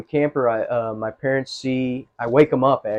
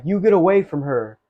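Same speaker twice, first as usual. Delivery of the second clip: yelled high and loud at the officers; angry shout; 6 semitones higher, 5 dB louder than usual. Retold or imitated. Storming in and yelling at two officers to back off. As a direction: bark it out to protect her. Confronting officers holding a woman.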